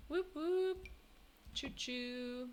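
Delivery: soft imitated train whistle; train noises. Streamer playing along with the hype train, imitating whistle. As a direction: toot like a little train.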